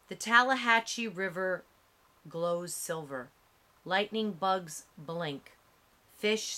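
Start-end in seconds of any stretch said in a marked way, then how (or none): none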